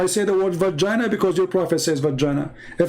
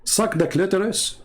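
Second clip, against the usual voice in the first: ordinary talk, nothing marked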